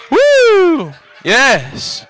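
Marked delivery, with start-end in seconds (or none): 0.1-0.9 short high "woo"; high whoop. Real, not acted. Preacher praying over someone, high whoop. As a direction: let out a revival whoop.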